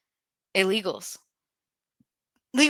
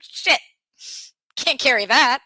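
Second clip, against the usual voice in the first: mock panic; 6 semitones higher, 5 dB louder than usual. Imitates her own panicked thought.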